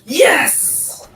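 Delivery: high triumphant yelp; mage's triumph. Acted. Mage breaking free of the chain, high gleeful cry. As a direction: snap out a gleeful yes. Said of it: "break free and be like".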